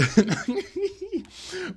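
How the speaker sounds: high-pitched squealing giggle. Real, not acted. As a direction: break into a real, high giggle. Cracks up after joking.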